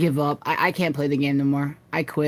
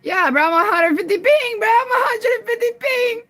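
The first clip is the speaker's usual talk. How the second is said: whiny cry; 3 dB louder than usual. Real, not acted. Complaining about lag, whining.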